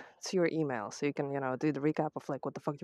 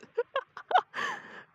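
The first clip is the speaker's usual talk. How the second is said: breathless high laugh. Real, not acted. Out of breath on hill.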